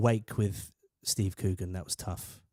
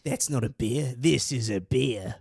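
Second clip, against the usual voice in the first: Crocodile Dundee; slower than usual. Retold or imitated. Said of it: "I referenced Crocodile Dundee".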